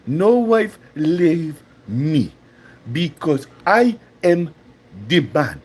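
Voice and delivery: hammed-up African accent with big pauses; mock African accent. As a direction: boast word by word in an African accent. Acts out Chaba's accent again.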